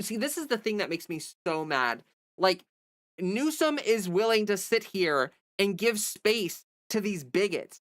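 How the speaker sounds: usual speaking voice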